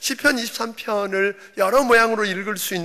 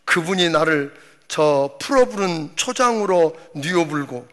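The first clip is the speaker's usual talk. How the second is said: lively Jeolla dialect reading, a bit louder; 3 dB louder than usual. Pretend. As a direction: preach the psalm like a Jeolla grandpa. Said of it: "전라도 버전은".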